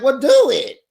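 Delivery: high, loud challenge; loud dare. Retold or imitated. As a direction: snap out the dare. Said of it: "Evelyn's like".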